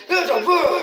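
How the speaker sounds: loud high barked order; re-enacted yelling. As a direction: shout it in mock fury.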